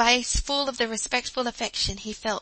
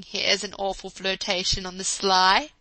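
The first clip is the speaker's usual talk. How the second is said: mock-scandalised teasing; 6 dB louder than usual. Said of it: "cried Teddy".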